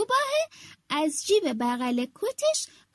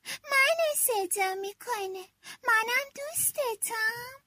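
goldfish voice; 7 semitones higher than usual. Acted. Goldfish in the pond telling the fox it is a friend too. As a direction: speak up shyly and sweetly. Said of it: "ماهی طلا از توی برکه گفت".

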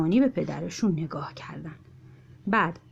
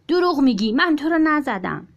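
put-on child's quarrel; 7 semitones higher, 4 dB louder than usual. A narrator's reading.

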